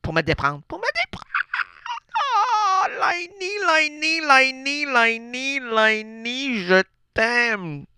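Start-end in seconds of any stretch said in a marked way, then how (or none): 0.7-1.3 much higher, pleading repeat; lovesick repeat. Acted. Echoing his own plea to Lainey, high and pleading. 2.2-7.8 high calls sinking down to normal; lovesick calling. Acted. Longing cries to Lainey, high then settling.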